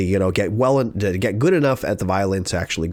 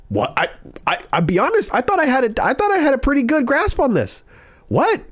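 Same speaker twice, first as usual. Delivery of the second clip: very high, stammering mock protest; flabbergasted. Student defending the summer's practice to teacher, flabbergasted. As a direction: squeak it out in wounded disbelief. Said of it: "I was flabbergasted".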